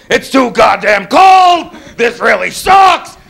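exasperated shouted rant; mad right away. Acted. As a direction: gripe about it at full voice, stretching the last word. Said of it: "I get mad right away".

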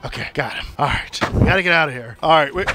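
usual speaking voice

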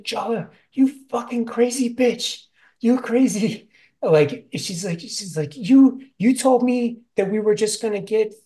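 high, loud furious yelling; mock freak-out. May yelling at Jala, furious. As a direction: rage at her for lying.

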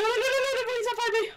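high desperate begging; terrified pleading. Real, not acted. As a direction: plead not to be found. Begging while hiding.

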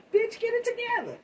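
high, mocking snap at herself; mock viewer scolding. Teasing herself as impatient viewers, high mock scold. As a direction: playfully voice her viewers telling her off. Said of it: "I know y'all like".

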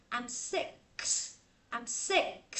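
"sick" with a separate s tacked on; careful build-up of "sick" plus s into six; added final s. Shown as a demonstration. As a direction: say "I'm sick", then a detached s after the k. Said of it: "thêm chữ sờ đuôi vô".

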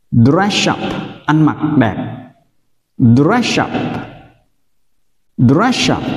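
ordinary talk

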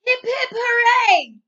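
loud, high, slow cheer. Acted. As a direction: belt out a joyful cheer for the winner. Crowd cheers the winner.

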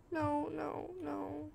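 usual speaking voice

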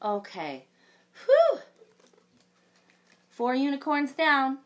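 lively storybook read-aloud with big reactions; reading to children. A narrator's reading. Librarian reading a unicorn story to children, animated. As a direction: read it like a picture book for kids.

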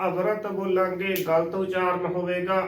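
normal talking voice

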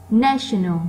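NA in "national" punched loud; stressed first syllable. Shown as a demonstration. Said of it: "nó to hơn những âm còn lại". (nhấn mạnh âm một của "national")

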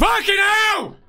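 sudden high shout of fright; real jump-scare shout; startled shout. Real, not acted. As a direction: jolt out a scared yell. Jump scare in ghost video.

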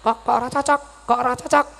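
high-pitched hen clucking in words; talking-hen cackle. Acted. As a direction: cluck it out like a questioning chicken. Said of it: "Lah krungu mak".

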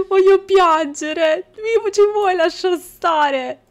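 high, slow, loud mock wail; mock whining. Gamer whining at the pestering baby, high slow mock wail. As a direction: whine as if about to cry, hammed-up. Overwhelmed by crying babies.

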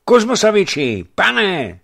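high, loud cry to a sleeper; urgent waking call. A narrator's reading. Messenger shaking the sleeping master, loud urgent call. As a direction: shout him awake.

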